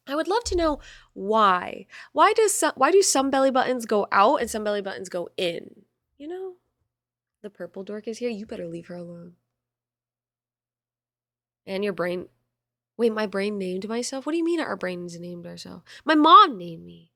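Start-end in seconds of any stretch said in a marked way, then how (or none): 0.1-4.5 sudden high wondering; high-pitched curious outburst; curious squeak. Real, not acted. 16.0-17.1 louder, slow mock protest; slow, louder, jokingly offended; mock indignant. Real, not acted.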